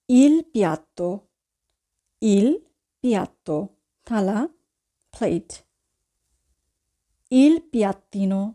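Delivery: ordinary delivery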